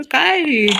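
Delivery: normal talking voice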